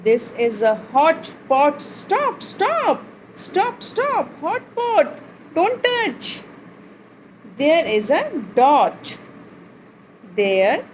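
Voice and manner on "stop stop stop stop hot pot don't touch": high-pitched warning, not shouted; acted warning. Teacher warns babies off a hot pot, high-pitched warning. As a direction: warn small children in a high voice.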